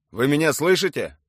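forceful commander's yell; shouting. A narrator's reading. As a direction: shout an ultimatum at the house. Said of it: "прокричал Юрий".

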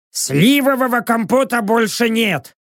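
voiced bar server. A narrator's reading.

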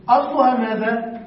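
ordinary talk

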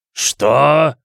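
loud demonic bark. Acted. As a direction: snap it out, loud and shocked. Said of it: "рявкнул демон". (рявкнул в ужасе)

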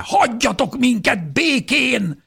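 very high, drawn-out yell; furious howl. A narrator's reading. Defender orders mob to leave family alone, furious howl. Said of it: "üvöltötte".

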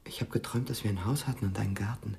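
dreamy and tender. Acted. Frank tells Mia his dream, soft and tender. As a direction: murmur the dream softly.

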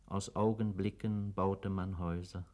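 ordinary delivery

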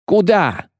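high, frantic cry; strained yell. A narrator's reading. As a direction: yell it in alarm. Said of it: "надрывался Фиш". (надрывный крик)

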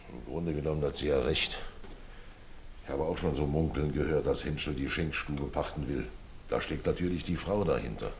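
leaning in to share rumours quietly; confiding. Acted. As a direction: keep it between us.

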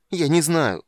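loud, high, abrupt cry; curt shout. A narrator's reading. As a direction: bark it out in distress. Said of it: "отрывисто крикнул Полин".

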